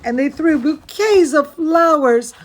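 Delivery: ordinary delivery, nothing marked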